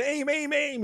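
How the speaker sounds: high-pitched mock yell; hammed-up urgent command; yelling impression. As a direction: bark orders at a buddy, imitated. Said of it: "He's yelling at his little buddy".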